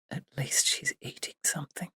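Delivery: barely voiced, very soft aside; a relieved thought, whispered. Emily quietly relieved her friend eats, in a whisper.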